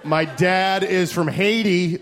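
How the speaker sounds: ordinary delivery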